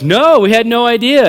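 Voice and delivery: high, loud feigned ignorance; mock astonishment. Friends playing along with the prank, loud mock surprise. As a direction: play along loudly, as if astonished.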